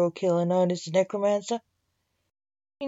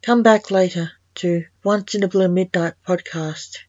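louder, upbeat outro announcement; podcast sign-off; 6 dB louder than usual. Real, not acted. Host invites listeners back for more stories, podcast sign-off.

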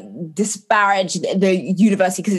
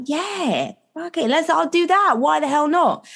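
higher, eager yes; excited agreement; 8 semitones higher than usual. Retold or imitated. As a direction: toss it off with a thrill. Retold own eager reply.

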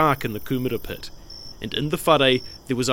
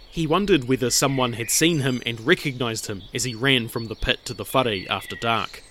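ordinary delivery

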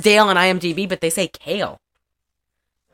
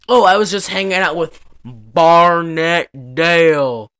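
mock drunk Stallone; 6 dB louder than usual. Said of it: "and he's like".

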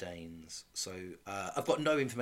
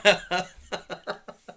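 burst of laughter; 8 semitones higher, 10 dB louder than usual. Real, not acted. Laughing at own big finish.

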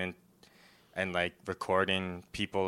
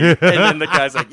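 normal talking voice

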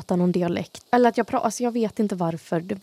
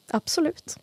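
sarcastic; 5 semitones higher, 4 dB quieter than usual. Real, not acted. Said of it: "Det där är lite så sarkastiskt".

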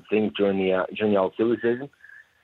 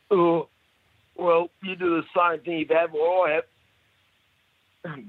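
higher, slow, slurred-sounding bloke; drunk bloke's reply; 8 semitones higher, much slower than usual. Retold or imitated. Caller voicing the bloke answering the barman, slow and high. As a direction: drag it out like the pale-faced drinker. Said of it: "He goes".

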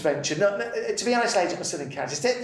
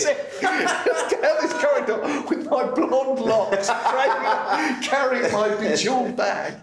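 laughing through the words, higher and louder; 8 semitones higher, 5 dB louder, slower than usual. Real, not acted. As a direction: tell it while cracking up. Laughing while telling the escape.

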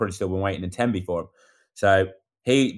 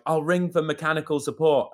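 mimicking the woman; 8 semitones higher than usual. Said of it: "the woman was like".

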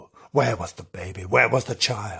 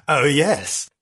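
ordinary talk, nothing marked

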